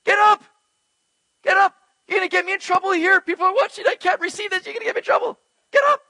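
frantic, high-pitched rebuke; emphatic angel. Acted. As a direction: play an alarmed angel urgently ordering someone up. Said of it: "quick, intense, and punctuated command".

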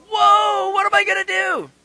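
high, loud panicked wail; hammed-up shout of helpless alarm; mock freaking out. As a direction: cry out in exaggerated panic. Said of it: "and just freaking out".

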